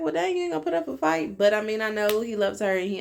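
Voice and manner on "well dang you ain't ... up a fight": high-pitched, quick incredulous reaction; high, rushed mock protest; mock disbelief. Retells own reaction to his giving in.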